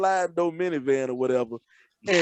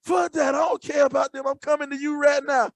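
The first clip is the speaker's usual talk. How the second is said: put-on drunk yelling; 8 semitones higher than usual.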